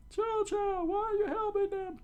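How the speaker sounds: very high falsetto wail; bewildered townspeople. Acted. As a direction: plead with the dog in a squeal. Townspeople plead with the dog.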